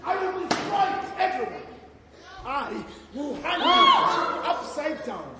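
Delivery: hammed-up headmistress escalating threats to a shout; building threat.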